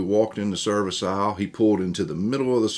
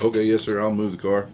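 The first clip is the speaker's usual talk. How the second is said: higher, louder, hurried compliance; eager yes sir; 3 semitones higher, 6 dB louder than usual. Retold or imitated. Teen hastily obeying a rude service guy, eager and loud. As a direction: blurt it out like a startled kid obeying.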